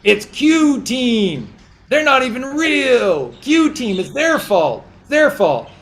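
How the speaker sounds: high, loud, whiny mock voice of people blaming others; mocking blame-shifters. Preacher mocking believers who blame Trump and the Q team, in a high whiny voice. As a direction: squeal it like someone dodging all responsibility. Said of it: "And guess who you'll blame?".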